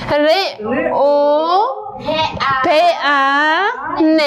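ر او ق ان, each sound said on its own, very slowly. Shown as a demonstration. Teacher sounding out each letter for pupils, very slow. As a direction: spell out the sounds one by one.

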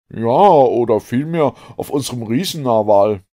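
loud, high, emphatic harpooner; Ned. A narrator's reading. Ned reveals his refuge, loud hearty voice. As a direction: declare it boldly to the professor. Ned corrects himself to the professor.

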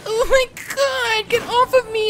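very high, loud, hammed-up screaming; panicked shrieking. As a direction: shriek as if being grabbed in a game. Cries out at attack in game.

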